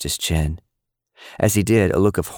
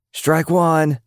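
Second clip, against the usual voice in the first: shouting; 11 semitones higher than usual. A narrator's reading. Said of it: "Austin Little shouted".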